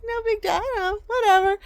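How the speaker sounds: high, dismissive mock indifference; imitating uninterested mom. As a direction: shrug it off in a put-on high voice. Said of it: "She just didn't care".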